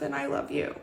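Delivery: high, soft, emotional quote; tearful affection. Retold or imitated. Boss tells fired employee she loves her, tearful affection. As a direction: tenderly, voice lifted with emotion. Boss's tearful goodbye, retold.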